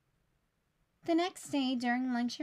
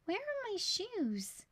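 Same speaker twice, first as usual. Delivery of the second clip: high, soft and slow bewildered ask; puzzled teacher; 8 semitones higher, 4 dB quieter, slower than usual. Acted. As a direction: wonder aloud, a little lost. Teacher's comic closing question.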